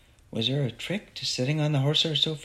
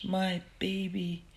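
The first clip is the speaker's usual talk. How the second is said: soft, slow, higher-pitched tenderness; tender mother; 7 semitones higher, 5 dB quieter, slower than usual. Acted. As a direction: draw it out softly, lovingly. Mother embraces her found daughter.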